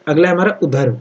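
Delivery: normal talking voice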